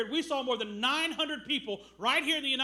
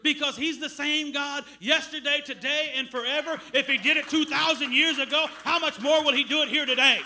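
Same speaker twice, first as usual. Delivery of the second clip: preaching at full force; 6 dB louder than usual. Acted. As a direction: proclaim it to the congregation. Preaching about God's power.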